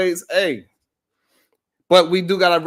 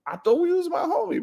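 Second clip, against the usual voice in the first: ordinary talk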